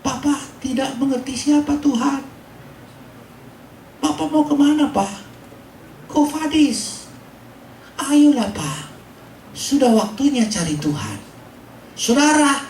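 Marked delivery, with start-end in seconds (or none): 0.0-11.2 high, lightly acted plea of a crying daughter; a hint of weeping, pitched high; imitated tearful pleading. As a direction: plead with dad in a raised, retold voice. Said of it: "sambil dia menangis, memeluk saya".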